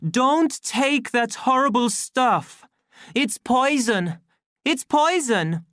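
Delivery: very high, loud, slow cries; desperate warning. A narrator's reading. Savage warning deltas off soma, desperate cry. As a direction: plead urgently across a hall.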